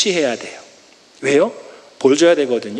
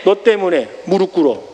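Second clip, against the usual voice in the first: stern command; 3 semitones lower than usual. Acted. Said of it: "이렇게 해야 되는 것이 맞거든요".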